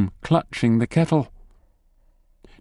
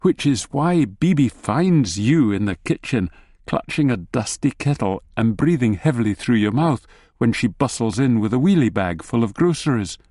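ordinary delivery, nothing marked

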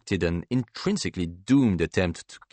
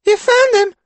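put-on very high, loud child voice; piping, eager child shout; excited little boy; 9 dB louder than usual. A narrator's reading. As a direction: squeal the question like a hopeful kid. Child rushes in at news.

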